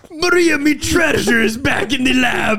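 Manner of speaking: loud, high hammed-up pirate shout; pirate begging to go back for his treasure. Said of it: "but a pirate".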